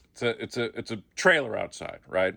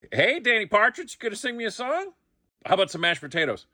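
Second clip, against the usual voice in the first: much higher, teasing; hammed-up taunt, pitched way up; mock taunting voice; 10 semitones higher than usual. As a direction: needle him like a schoolyard bully. Acting the other actor's line.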